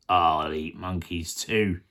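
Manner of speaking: very low, slow crocodile voice; menacing crocodile. Acted. Crocodile threatening the monkey, very low and slow. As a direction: growl it out deep and unhurried. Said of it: "The crocodile grinned up".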